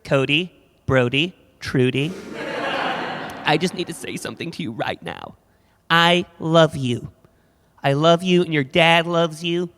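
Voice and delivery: put-on gather the kids in an American accent; American parent. American mother holding family intervention, earnest American accent. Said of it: "hear Americans talking to their kids".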